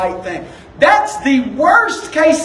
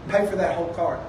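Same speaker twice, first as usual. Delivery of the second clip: quiet divine instruction; 5 semitones lower, 7 dB quieter, faster than usual. Retold or imitated. Voices the Lord's quiet instruction.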